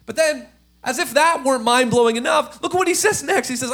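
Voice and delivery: usual speaking voice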